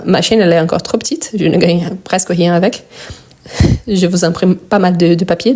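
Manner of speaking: normal talking voice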